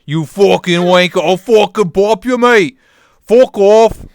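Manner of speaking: put-on high, loud pub-brawler British accent; hammed-up British yelling. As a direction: threaten like a rowdy British lout. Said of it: "There's your British voice".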